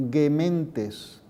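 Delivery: "Gementes" with a hard G like in "gato"; classical Latin. Shown as a demonstration. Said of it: "El sonido G es gagegigogu".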